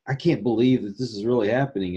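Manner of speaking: usual speaking voice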